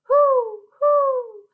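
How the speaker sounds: high, loud owl hoot. Pretend. Teacher showing children what an owl says, high hooting. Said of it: "what does an owl say".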